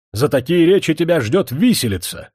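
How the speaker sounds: громкая высокая угроза; пригрози виселицей в полный голос; злая угроза. Acted. Князь грозит виселицей. (князь грозит Кашкину виселицей, злобно и громко)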